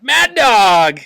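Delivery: teacher shouting a playful nickname; mock shout. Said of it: "And Mister Hull went".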